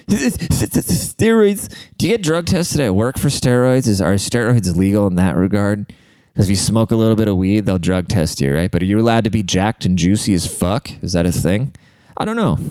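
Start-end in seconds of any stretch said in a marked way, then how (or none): 0.1-1.7 word repeated way up high, drawn out; mock-dreamy high repeat of steroids; high echo. Real, not acted. 12.2-12.6 squeaky high I don't know; mock-clueless high shrug. Real, not acted. Jokey shrug.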